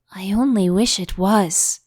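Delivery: plain reading voice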